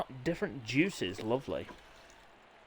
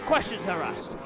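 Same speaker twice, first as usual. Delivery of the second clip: lively play-by-play commentator voice; upbeat live bike race commentary; 4 semitones higher than usual. Acted. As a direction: call the race like a TV motorsport commentator.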